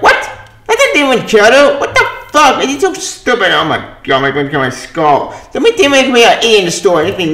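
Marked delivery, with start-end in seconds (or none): 0.0-3.5 high, outraged disbelief; exasperated rant. Real, not acted. To viewers, outraged at the comic's writing, pitched high. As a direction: throw your hands up at it.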